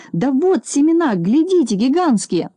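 excited. A narrator's reading. Proudly showing the seeds.

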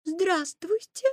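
put-on slow, high little-girl greeting; high, drawn-out polite child. A narrator's reading. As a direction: greet courteously as a small girl. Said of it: "вежливо сказала красная шапочка".

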